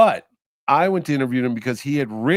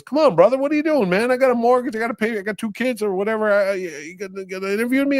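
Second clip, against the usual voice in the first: much higher, eager begging actor voice; pleading; 9 semitones higher than usual. Retold or imitated. Bernthal begging the host to stay, high and pleading. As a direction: plead high and pushy, don't let him walk away.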